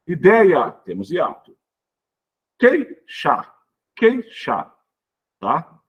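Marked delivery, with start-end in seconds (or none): 2.6-4.7 "Queixar" as QUEI, pause, XAR, pitched high; split into syllables. Shown as a demonstration. Teacher repeating a non-hiatus for pupils, syllables split. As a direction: break "Queixar" only after QUEI, EI held together. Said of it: "isso aqui é tudo junto na mesma sílaba".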